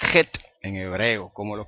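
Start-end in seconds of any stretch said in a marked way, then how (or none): 0.1-0.3 "jet" with a throat-scraped kh; rasped velar start on "jet"; Puerto Rican-style chet. Shown as a demonstration. Teacher showing how the Hebrew letter is said, guttural kh. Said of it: "así es como se pronuncia".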